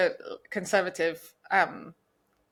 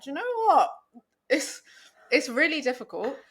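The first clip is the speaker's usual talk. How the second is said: much higher, mildly mock-exasperated; retold exasperation; 7 semitones higher, 3 dB louder than usual. Host retelling their reaction to the interviewer, mildly exasperated. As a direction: pitch it up high as if caught on the fence.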